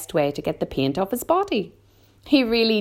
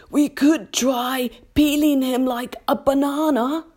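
higher-pitched creature voice; old grasshopper suggesting; 10 semitones higher than usual. A narrator's reading. Old Green Grasshopper suggests peeling the centipede, in character. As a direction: offer it as an old grasshopper's helpful idea. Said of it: "the old green grasshopper suggested".

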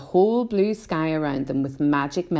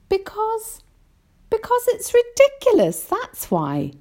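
put-on very high child, louder, dropping at the end; indignant boy; 4 dB louder than usual. A narrator's reading. James argues with the grasshopper, indignant. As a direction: protest high, then close it off.

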